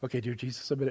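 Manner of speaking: low, soft mock repetition; meek repeater. Person at the altar repeating after the leader, meek mock echo. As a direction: mumble the prayer back obediently. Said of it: "just repeat after me".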